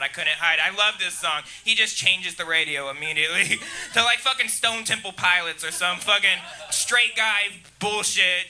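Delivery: ordinary talk, nothing marked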